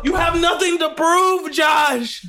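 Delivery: shouting. Real, not acted. Urging friend to quit challenge.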